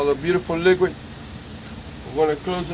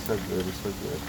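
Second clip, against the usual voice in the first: hushed; 10 semitones lower, 13 dB quieter than usual. Real, not acted. Soft delight at the machine.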